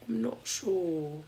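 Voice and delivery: slow attempt at an English accent; lightly put-on English accent. Shown as a demonstration. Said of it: "Trying to sound English".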